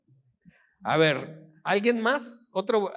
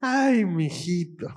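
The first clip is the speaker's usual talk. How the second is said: mamá exasperada; slower than usual. Shown as a demonstration. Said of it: "Cabeza de chorlito".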